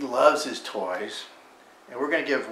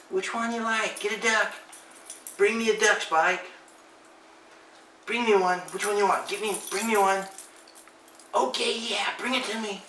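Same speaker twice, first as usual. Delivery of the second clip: high sing-song pet talk; dog-directed voice; 9 semitones higher than usual. Real, not acted. Talking to the dog.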